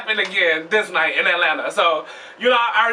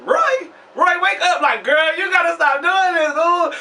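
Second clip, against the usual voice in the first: friends calling; 8 semitones higher, slower than usual. Retold or imitated. People from his city waking him outside the club, high fussing calls.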